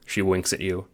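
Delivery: ordinary delivery, nothing marked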